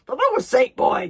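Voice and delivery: loud, high exclamation; grinning bellow. Acted. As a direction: bellow it through a grin. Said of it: "grinned Gobber the Belch".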